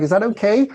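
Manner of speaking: "okay" lifted high at the end; questioning uptick. Shown as a demonstration. Unsure seller looking for reinforcement from the buyer, questioning uptick. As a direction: ask it with a rising, approval-seeking end. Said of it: "tipping the end of that question up".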